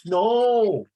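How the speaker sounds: high, loud shocked protest; loud appalled no; indignant outburst. Real, not acted. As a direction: scold the rudeness in disbelief. Teacher objects to rude answer.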